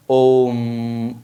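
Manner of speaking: ओम held long with a stretched vowel; long drawn-out Om. Shown as a demonstration. Teacher to pupils, showing a pluta vowel: ओम drawn out long. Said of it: "लंबा खींच के ले आते हैं ना ओम".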